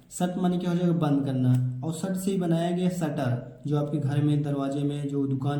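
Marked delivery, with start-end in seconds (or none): none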